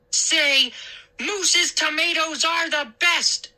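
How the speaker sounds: high, drawn-out command; bossy order. A narrator's reading. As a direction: order him like a bully. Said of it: "he ordered".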